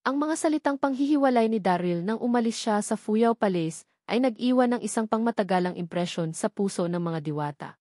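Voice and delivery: ordinary delivery, nothing marked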